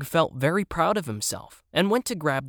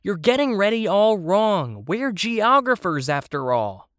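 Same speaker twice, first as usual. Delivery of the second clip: mildly exasperated, high and louder at first; irritated; 7 semitones higher, 5 dB louder than usual. A narrator's reading. Child tells the toys they're doing it wrong, mildly irritated. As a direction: scold them, then settle on the last words. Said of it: "I said in irritation".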